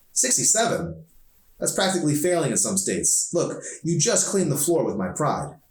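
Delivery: high, sharp disbelief at the score; indignant disbelief. A narrator's reading. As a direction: scoff at the number, offended. Arson indignant at low score.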